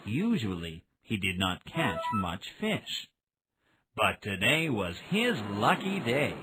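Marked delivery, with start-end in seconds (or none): none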